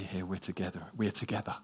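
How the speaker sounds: Jesus at the wedding feast, hushed and fading; fading whisper. Retold or imitated. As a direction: let it fade to a whisper. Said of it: "and say".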